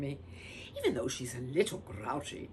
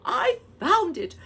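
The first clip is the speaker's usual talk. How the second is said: triumphant; 12 dB louder than usual. Acted. Triumphant find.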